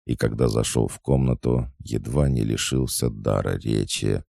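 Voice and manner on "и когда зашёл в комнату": ordinary delivery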